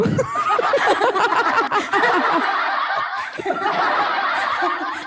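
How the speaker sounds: long high pitched laughter. Real, not acted.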